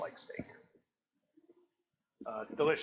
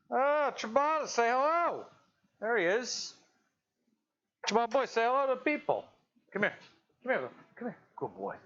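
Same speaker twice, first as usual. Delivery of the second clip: doggy talk; 9 semitones higher than usual. Real, not acted. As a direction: croon up high like greeting a beloved pet. Greeting the dog on camera.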